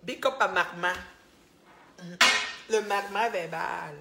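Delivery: loud, drawn-out banter; loud shout-out to Magma; playful loud. Pretend. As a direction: call it out loud to the chat. Loud live banter, drinking water.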